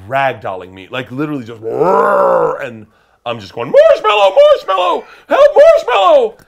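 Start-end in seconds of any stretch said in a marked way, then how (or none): 1.6-2.6 loud, drawn-out bear roar; imitated bear roar. Imitating the bear mauling him. 3.7-6.4 high-pitched, full-volume scream for help; desperate screaming. Retold or imitated. Acting out desperate safe-word cries.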